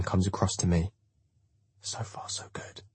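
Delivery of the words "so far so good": slow, very soft whisper. A narrator's reading. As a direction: whisper it privately to an ally. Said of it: "I whisper".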